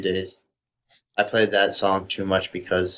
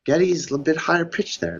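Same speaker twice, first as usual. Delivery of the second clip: higher-pitched music-nerd voice; 5 semitones higher than usual. Acted. Speaker mimes a chin-stroking Rush fan, mock nerd voice. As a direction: play a pedantic superfan musing into the mic. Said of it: "super hardcore Rush fan's doing".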